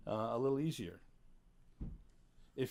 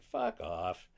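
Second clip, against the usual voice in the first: normal talking voice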